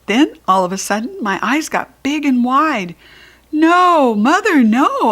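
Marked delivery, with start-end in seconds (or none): 3.5-5.1 high, loud desperate no; child protesting the scissors; shouting no. Acted. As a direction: shout it in protest, much louder. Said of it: "I shouted".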